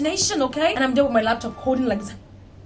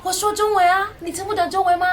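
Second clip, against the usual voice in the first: higher, fast exasperated protest; mock frustration; 8 semitones higher, faster than usual. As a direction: push back, fed up. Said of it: "And then you're like".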